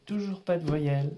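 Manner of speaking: normal talking voice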